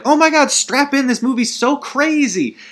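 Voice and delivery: high-pitched hammed-up excitement; mock hype. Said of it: "I could do, like, one of these sorts of".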